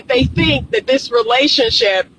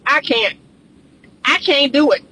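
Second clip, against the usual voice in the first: normal talking voice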